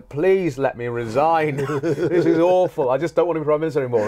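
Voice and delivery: high, loud, begging prime minister; a weary politician begging to quit, loud and high; whiny pleading minister. Retold or imitated. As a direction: plead to resign, half laughing. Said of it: "And this bloke going".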